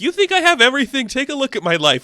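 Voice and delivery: bitter shout. Retold or imitated. Mark yelling at Randall to look at his life. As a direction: hurl it at him. Acted brothers' quarrel.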